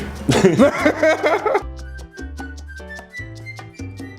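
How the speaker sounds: high laugh trailing into silence. Real, not acted. Tasters find the food weird, laughing. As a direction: laugh hard, then let it fade.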